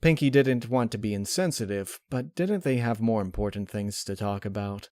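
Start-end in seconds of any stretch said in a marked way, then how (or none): none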